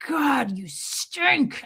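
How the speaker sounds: mock husband's disgusted griping; retold mad husband. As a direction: complain like an annoyed husband. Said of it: "Michael would get mad at me".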